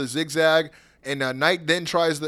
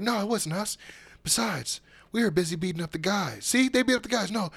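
mock wrestler reply; 6 dB quieter than usual. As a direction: brush it off in a light mock voice. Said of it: "they were like".

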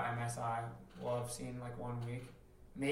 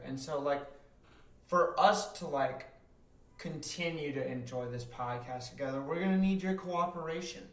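good cop; 4 semitones higher, 4 dB louder than usual. Acted. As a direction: coax them like a good cop, stretching the phrases. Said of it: "good cop this time".

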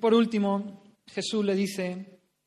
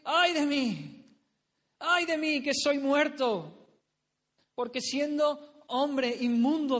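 anguished Isaiah; 3 semitones higher than usual. Acted. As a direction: wail it out as Isaiah seeing God. Preacher voices Isaiah's lament.

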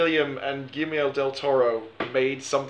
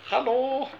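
bad German accent; 8 semitones higher, 3 dB quieter than usual. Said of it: "What was like".

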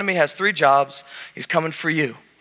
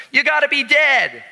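put-on shouting little boy; 9 semitones higher than usual. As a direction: blurt it out from the back like a proud five-year-old. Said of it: "a five-year-old boy shouted".

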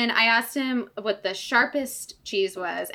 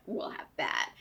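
whispered quote; 8 semitones lower, 10 dB quieter than usual. Retold or imitated. Quoting herself ordering quietly.

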